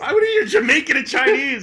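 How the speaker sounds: high, loud disbelieving shout; scandalised comic yell; incredulous outburst. Real, not acted. Mock outrage at the accent claim.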